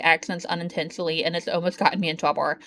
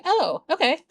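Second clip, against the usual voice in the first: lightly voiced, higher mock surprise; surprised "oh okay"; 8 semitones higher than usual. Streamer told she copies accents, mild mock surprise. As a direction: act caught off guard, a touch higher. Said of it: "I'm like".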